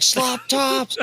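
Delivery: high, yelled Slop Tops with Slop and Tops both shouted up high; shouted catchphrase. Retold or imitated. Player yelling the catchphrase at the table, high shout. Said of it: "He's like".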